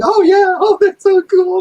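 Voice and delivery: high, loud gushing German fan; mock German fans. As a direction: gush like a starstruck German fan. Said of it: "and the Germans all going".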